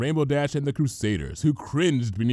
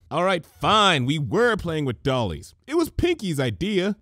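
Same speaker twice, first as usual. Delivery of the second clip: exasperated admission; 6 semitones higher, 4 dB louder than usual. A narrator's reading.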